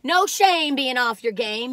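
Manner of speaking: normal talking voice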